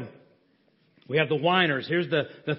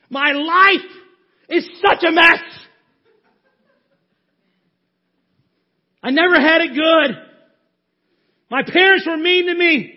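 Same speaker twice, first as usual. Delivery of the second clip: mock self-pitying whine; 7 semitones higher, 5 dB louder than usual. Preacher acts out self-pitying victim.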